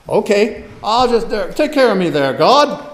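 loud, high-pitched mock appeal; acted plea. Believer handing himself over to God, acted plea. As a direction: call up to God in a put-on voice.

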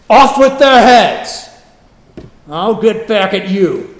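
slow, high, very loud decree; mock vengeful shout. Joseph's imagined response to his brothers, hammed-up vengeful shout. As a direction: bellow it like a tyrant ordering executions.

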